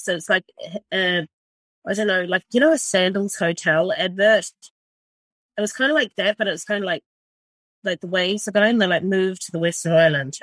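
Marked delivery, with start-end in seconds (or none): none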